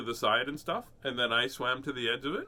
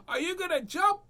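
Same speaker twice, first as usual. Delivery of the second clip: put-on high little boy asking; imitating a young son's question; child voice. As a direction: ask like an eager small kid. Son's voice retold.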